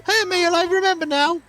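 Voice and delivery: high-pitched put-on character voice; perky game villager. Villager giving a gift and chatting with a friend, perky and high.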